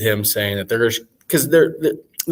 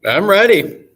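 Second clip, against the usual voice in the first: echo chamber; 9 semitones higher, 6 dB louder, slower than usual. Real, not acted. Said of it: "You sound like you're in an echo chamber".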